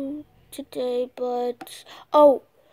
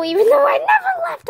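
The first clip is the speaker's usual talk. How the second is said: catchphrase; 11 semitones higher, 4 dB louder than usual. Pretend. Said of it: "I love saying that".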